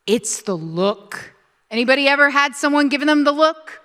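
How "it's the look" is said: slow emphasis. Shown as a demonstration. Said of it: "slow emphatic repeat".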